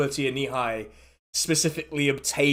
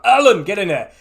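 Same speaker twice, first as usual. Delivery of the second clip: louder, higher summoning shout; brisk holler for an underling; calling out; 5 semitones higher, 8 dB louder than usual. Acted. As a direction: call someone in from outside. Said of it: "he sort of calls out".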